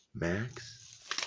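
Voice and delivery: hushed, sleepy whisper in the dark; a soft, clouded whisper from the throat of sleep. A narrator's reading. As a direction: whisper his name so as not to wake the house. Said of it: "The whisper was soft, clouded".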